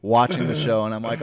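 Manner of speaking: ordinary talk, nothing marked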